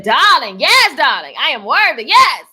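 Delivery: high, emphatic, swooping affirmation; quoted "darling" exclamation. Retold or imitated. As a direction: play up each "darling" with big pitch swoops. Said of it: "Jose said".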